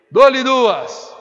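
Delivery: loud auctioneer call. Real, not acted. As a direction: ring out the going-twice call. Auctioneer's going-twice call. (chamada alta de leiloeiro)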